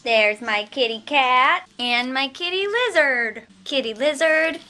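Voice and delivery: high, slow cooing pet voice. Real, not acted. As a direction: coo at the sleepy kitten. Talking sweetly to her pets.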